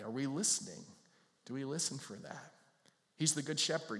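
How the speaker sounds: ordinary talk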